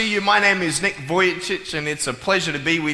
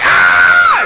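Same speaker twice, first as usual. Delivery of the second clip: put-on girl's scream; 12 semitones lower, 12 dB louder than usual. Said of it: "She's like".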